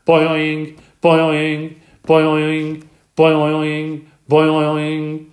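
loud, high springy twangs; croaking frog boing. A narrator's reading. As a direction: twang each boing hard. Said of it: "all that croaking".

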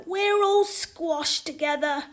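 put-on high, loud, slow child's complaint; little brother. A narrator's reading. Little boy squashed in a crowd, childish complaint. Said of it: "said her little brother".

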